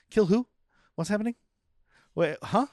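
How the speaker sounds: high, bewildered background interjections; Tom Cruise impression. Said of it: "Tom Cruise is like".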